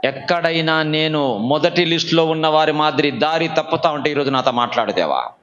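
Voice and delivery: low-pitched, earnest plea to God; led prayer. Real, not acted. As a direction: pray aloud for others to follow. Leads the congregation in prayer. (తగ్గు స్వరంతో ప్రార్థన)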